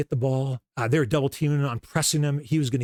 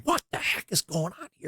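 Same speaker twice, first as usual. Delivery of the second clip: retold disbelief; 5 semitones higher, 5 dB quieter than usual. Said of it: "And we're going".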